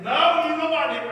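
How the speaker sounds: high, loud protest; retold indignant denial. Girl answering preacher's question, indignant. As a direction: burst out with her objection.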